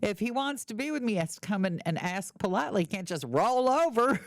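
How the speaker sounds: put-on playful Texas accent; Texas girlfriend. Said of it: "she's from Texas".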